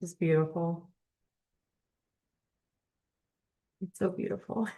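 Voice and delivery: quiet, tearful and hushed; moved to the edge of tears; choked up. Real, not acted. Reading spiritual text to viewers, choked up and hushed. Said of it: "All sorts of choked up right now".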